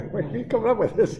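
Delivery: high-pitched, laughing mock bafflement; amused exasperation, breaking into laughter; laughing disbelief. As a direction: laugh through an incredulous question. Laughing while quoting himself, baffled.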